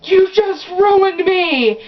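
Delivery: high, loud, slow dramatic wail; mock wail. Girl wailing at her mom, high and drawn out. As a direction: wail it like a ruined teen. Teen wails at embarrassing mom.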